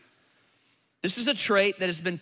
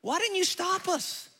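higher, softer mocking wife's retort; mock indignant wife; 10 semitones higher, 4 dB quieter than usual. Mocking the wives in the pews, higher and indignant. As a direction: needle him like an annoyed wife. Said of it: "ladies, before you get all mad at us and go".

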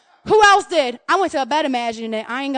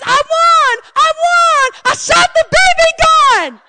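put-on much higher, louder kid chant; triumphant kid; 12 semitones higher, 5 dB louder, slower than usual. Boy chanting his win to the family, high and loud. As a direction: chant it like a boy skipping round the house. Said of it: "And he says".

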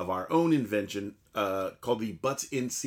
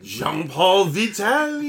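put-on French accent on the name; 6 semitones higher, 7 dB louder, slower than usual. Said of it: "for getting your accent wrong".

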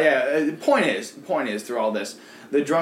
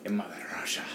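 put-on low, hushed Russian accent; mock Russian accent; 8 semitones lower, 12 dB quieter, faster than usual. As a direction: whisper it in a playful Russian accent. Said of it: "that's pretty good".

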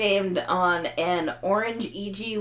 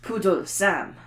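hammed-up bad French accent; a poodle's bad French accent; mock French accent on the name; slower than usual. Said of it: "Your bad French accent".

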